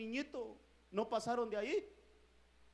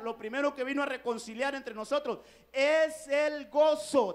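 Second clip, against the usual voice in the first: rising, louder preaching on the joy; fervent preaching; 2 semitones higher, 5 dB louder than usual. Real, not acted. Preacher building to the congregation, louder and rising. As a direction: build the sermon toward el gozo.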